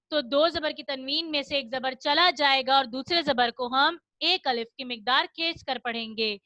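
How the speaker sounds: usual speaking voice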